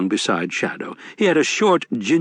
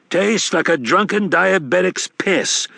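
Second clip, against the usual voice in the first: a touch higher, genial con man; sly old grifter, a bit higher; lightly voiced Wednesday; 9 semitones higher, slower than usual. A narrator's reading.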